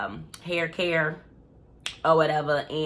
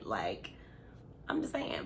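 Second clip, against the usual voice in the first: breathy whisper, dropped way down; whispered aside; 10 semitones lower, 13 dB quieter than usual. Real, not acted. To the viewers after a cheeky remark, a hushed whisper. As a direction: lean in and whisper it like a secret.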